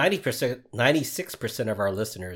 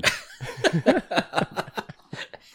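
breathy high burst of laughter; 9 semitones higher than usual. Real, not acted. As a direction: crack up at the denial. Laughing at cohost's denial.

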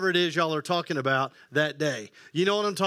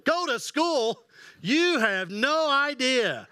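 put-on higher, slow old-timer scoff; old man voice; 6 semitones higher, slower than usual. Granddad scoffing at a kid's school complaint, high and slow. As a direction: scoff like a granddad who's seen worse.

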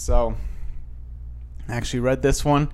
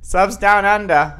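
loud, slow, higher fake Australian; bad Australian accent; 5 semitones higher, 7 dB louder than usual. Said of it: "with my horrible accent".

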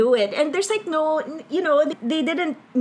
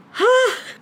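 swooning sigh; 12 semitones higher than usual. Real, not acted. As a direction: let out a big lovestruck sigh. Swooning over lovely dad scene.

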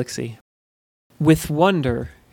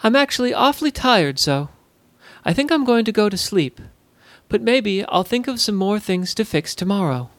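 feigned tiredness; 6 semitones higher than usual. Acted. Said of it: "feigned a yawn".

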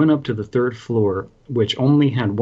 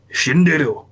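lightly voiced fighting-game ultimate cry; Akuma; 5 semitones higher, 3 dB louder than usual. Newport snaps and does his ultimate, mock battle cry. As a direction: call out a special move, higher pitched. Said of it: "turned into, uh, Akuma and did his ultimate".

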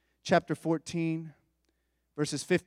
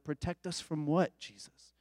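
nervous hushed question; 7 dB quieter than usual. Retold or imitated. Disciples nervously reply.

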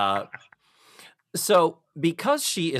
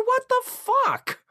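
incredulous; slower than usual. Real, not acted. Exasperated outburst.

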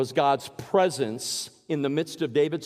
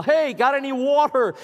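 higher, louder call across the water; retold yell; 7 semitones higher, 5 dB louder than usual. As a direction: hail a passing boat. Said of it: "they would yell".

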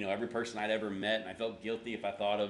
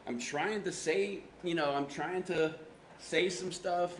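slow, higher, effortful words; frustrated struggle; 8 semitones higher, slower than usual. Retold or imitated. Pastor explaining to youth leader, struggling to speak. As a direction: labor over each word, pitch raised. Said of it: "I guess getting frustrated".

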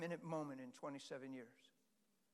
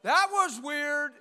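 loud, high startled exclaim; startled whoa; 9 semitones higher, 15 dB louder, much slower than usual. Retold or imitated. Pastor leaping up startled after the Spirit spoke, loud. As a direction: jump up amazed. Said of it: "I was like".

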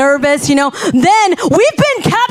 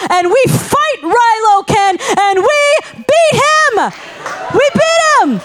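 higher, slower, victorious cry of winning; triumphant; 6 semitones higher than usual. Real, not acted. Preacher tells the church her family won the ride, triumphant. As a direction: crow about beating the villain.

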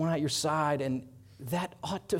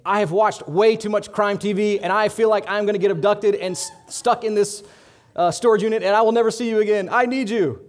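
high, loud, rushed panic; frantic fear; 6 semitones higher, 10 dB louder than usual. Retold or imitated. Wife calling her husband from a storage unit, frantic. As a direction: blurt it out like she's scared for her life. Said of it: "And Allie said to me".

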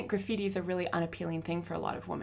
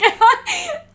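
high, loud burst of laughter; shrieking laugh; 17 dB louder than usual. Real, not acted. Laughing at the memory.

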